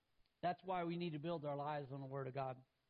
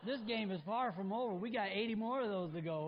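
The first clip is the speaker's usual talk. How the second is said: usual speaking voice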